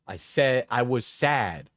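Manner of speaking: normal talking voice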